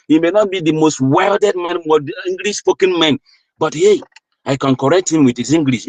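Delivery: rally the crowd with rising fervour; sermon-like plea to defend your brother, preached with rising fervour; impassioned preaching. Acted. Preaching at full force.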